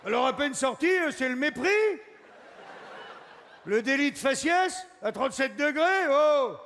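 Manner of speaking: loud, high indignant ranting; a scandalised ball demanding respect; outraged ball. Acted. The ball scolds the one poking it, loud and outraged.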